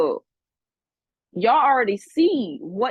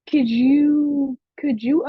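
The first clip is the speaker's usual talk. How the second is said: louder, rising disbelief; loud exclamation; 4 semitones higher, 5 dB louder, much slower than usual. Real, not acted. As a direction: call it out incredulous.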